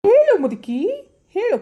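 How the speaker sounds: angry demand. Acted. Demanding an answer from old woman.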